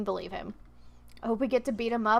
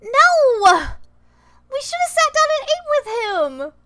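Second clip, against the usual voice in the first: high, much louder wail of protest; distressed outcry; 12 semitones higher, 9 dB louder than usual. Real, not acted. Player protesting to the game about a missing choice, loud wail. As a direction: cry out at the game for missing option. Upset over missing dialogue option.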